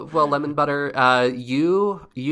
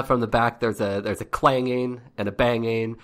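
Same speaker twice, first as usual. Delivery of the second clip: ordinary delivery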